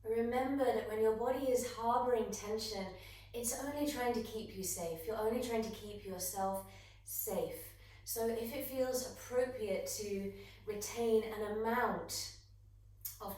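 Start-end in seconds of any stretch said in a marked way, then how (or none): none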